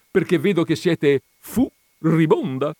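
high, loud punchline; jovial explanation. A narrator's reading. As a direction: land the pun cheerfully. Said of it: "spiegò giovialmente". (battuta gioviale)

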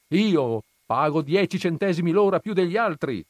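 loud, high-pitched insistence; emphatic, aggrieved cry; shouted protest. A narrator's reading. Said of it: "mio zio gridò".

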